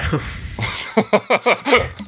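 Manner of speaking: breathy, rising burst of laughter; drawn-out laugh. Real, not acted. Cracking up after the Italian accent bit, breathy rising laugh. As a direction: crack up helplessly at the joke.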